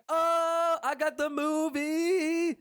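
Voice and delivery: excited high cartoon cry; Rigby. Cartoon raccoon running back with a tape, high drawn-out cheer. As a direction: stretch it out slow, way up high. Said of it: "and he's like".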